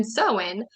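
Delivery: Samhain pronounced SOW-in; corrected pronunciation. Shown as a demonstration. Reader giving listeners the listed pronunciation, Samhain said SOW-in. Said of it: "up here it has the pronunciation".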